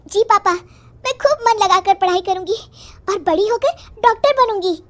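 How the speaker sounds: put-on high, loud, eager girl voice; happy daughter. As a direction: promise papa as an excited child. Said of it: "बिंदु खुश हो जाती है". (खुश बच्ची की ऊँची आवाज़)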